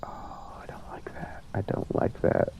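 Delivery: real nervous whisper; frightened whisper. Real, not acted. Player alone with a ghost, whispering uneasily. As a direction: whisper it, uneasy, barely audible at first.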